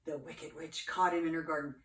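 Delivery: plain reading voice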